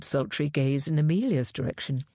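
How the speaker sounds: ordinary delivery, nothing marked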